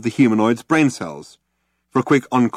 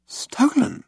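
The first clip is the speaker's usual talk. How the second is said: shocked. A narrator's reading. Ford's shocked question.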